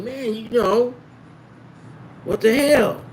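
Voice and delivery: high incredulous what-the-hell; retold confrontation.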